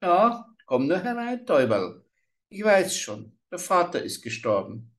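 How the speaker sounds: put-on high, sugary old witch. A narrator's reading. Old witch welcomes the lost girl, creepy sweet. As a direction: lure the child in sweetly like a hag.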